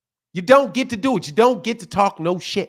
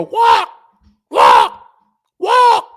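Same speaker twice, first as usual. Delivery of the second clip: loud cawing crow imitation with wild jumps in pitch; 11 semitones higher, 6 dB louder, much slower than usual. Telling fans what crows do, loud crow caws. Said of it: "They go".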